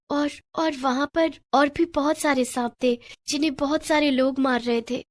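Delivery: put-on high girlish voice, hesitant; troubled young girl. Girl tells grandmother about the snakes in her dream, troubled. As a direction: recount a bad dream, still unsettled.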